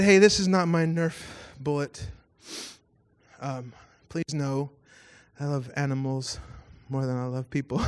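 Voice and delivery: sugary fake-sweet note reading; passive-aggressive. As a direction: read the note with a fake smile. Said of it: "in the most passive-aggressive way possible".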